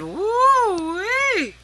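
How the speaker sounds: very high, loud, drawn-out whoop; triumphant whoop. A narrator's reading. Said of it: "and a triumphant".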